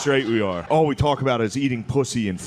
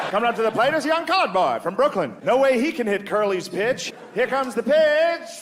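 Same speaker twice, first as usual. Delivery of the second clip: high play-by-play, stretching the last call; old-time radio announcer; 9 semitones higher than usual. Acted. Announcer calling Jackie Robinson at the plate, old-timey. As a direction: call the pitch in a vintage announcer voice. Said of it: "he knocked that voice out of all the whites".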